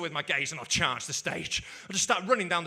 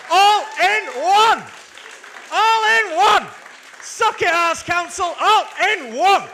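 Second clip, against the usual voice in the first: high, very loud victory cry; triumphant shout; 10 semitones higher, 15 dB louder, slower than usual. Real, not acted. Comedian taunting the Arts Council at show's climax, triumphant shout. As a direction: belt it out as a big finish.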